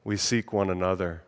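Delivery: reading a spring meditation aloud to the congregation, earnest; prayerful reading. Acted. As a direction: lead the congregation in reflection. Leads a reflective prayer.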